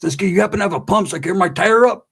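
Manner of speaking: put-on old homeboy hitting you up; lively mock greeting from a homeboy; homeboy impression. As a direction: play the pestering neighborhood buddy. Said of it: "He's like".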